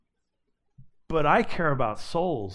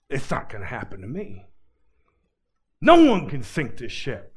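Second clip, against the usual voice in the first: proud boast; 6 dB louder than usual. Acted. Proud boast voiced against warnings.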